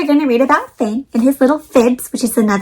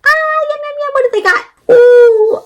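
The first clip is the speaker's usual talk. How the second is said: squealing high with delight over treats; high, thrilled oohs and yums; 12 semitones higher, 4 dB louder than usual. Acted. Reading to kids, delighted by grandma's treats.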